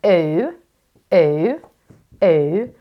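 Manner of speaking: long rounded oo, said slowly; drawn-out oo; modelled oo sound. Shown as a demonstration. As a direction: model the oo sound for children to copy. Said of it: "U split E makes an oo sound".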